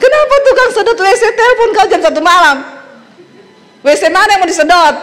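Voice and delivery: fast, high accusing questions; a wife grilling her husband about a one a.m. caller, fast and accusing; suspicious wife. Retold or imitated. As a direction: fire the questions at him, high and rushed.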